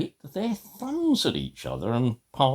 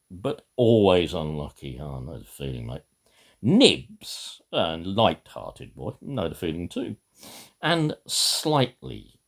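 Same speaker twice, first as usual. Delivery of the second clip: ordinary delivery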